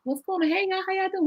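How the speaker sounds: bright, high, friendly hello to the room; breezy high-pitched call to break the tension; cheery greeting. Retold or imitated. Walking into a tense store, greeting everyone brightly.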